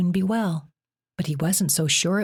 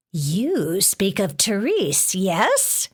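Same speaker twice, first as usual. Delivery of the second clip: slow, stern, scratchy monster voice; 3 semitones higher, much slower than usual. A narrator's reading. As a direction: press her coldly like a scratchy-voiced beast. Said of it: "Melano asked".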